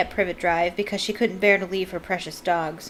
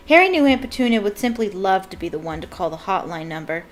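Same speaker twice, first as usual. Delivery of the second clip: ordinary delivery, nothing marked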